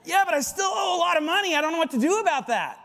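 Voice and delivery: high, loud exasperated cry; hammed-up panicked shout about debts; mock distressed protest. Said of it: "retorting believer in crisis".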